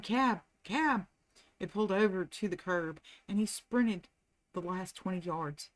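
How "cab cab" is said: higher calls to hail a cab; fleeing man hailing a passing cab, mild shout. A narrator's reading. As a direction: call out down the street. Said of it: "He shouted".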